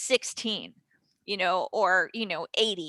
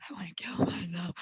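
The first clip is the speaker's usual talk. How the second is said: despairing whisper; 2 semitones lower, 5 dB quieter than usual. Retold or imitated. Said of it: "you're like".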